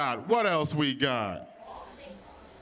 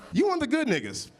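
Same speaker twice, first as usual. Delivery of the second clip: coworker's praise; 10 semitones higher than usual. Retold or imitated. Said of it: "He's like".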